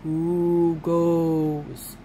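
guard impression. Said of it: "this guy right here".

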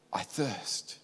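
very soft, low retelling of a dying cry; hushed quote. Retold or imitated. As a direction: let the quote fall nearly to nothing. Quoting Jesus's words from the cross.

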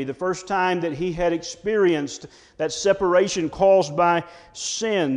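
usual speaking voice

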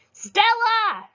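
put-on high, loud boy's call; shouted. A narrator's reading. Brother Frank shouts a warning to Stella, high and loud. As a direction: yell her name in alarm. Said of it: "shouted a boy".

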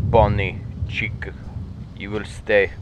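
mock Russian accent; commanding words in a put-on Russian accent. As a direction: slip into a playful Russian accent. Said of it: "I'm speaking a Russian accent".

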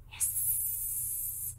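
long unvoiced hiss; snake hiss. Snake hisses at the mouse.

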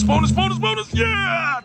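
rising squeal into a shriek; shrieking jackpot winner. Real, not acted. Chanting for bonus, it hits.

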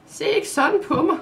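high, loud, out-of-breath outburst; breathless exclamation. Acted. Maja, winded from chasing the dog, high breathless outburst. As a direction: blurt it, still panting from running. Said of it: "udbrød hun tø-, forpustet".

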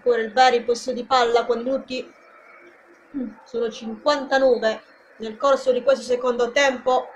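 lively play-by-play call; live football commentary. Acted. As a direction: call the match for the fans.

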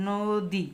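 নদী as 'nooo-di', long rounded 'o'; drawn-out o vowel. Shown as a demonstration. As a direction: stretch the ও sound in নদী. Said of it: "ও এর মতো হয়েছে".

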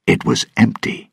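usual speaking voice

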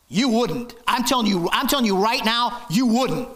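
rising preacher's insistence; emphatic insistence. Real, not acted.

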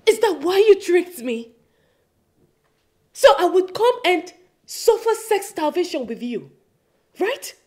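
angry accusation. Acted. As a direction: throw it in his face. Wife accusing her husband loudly.

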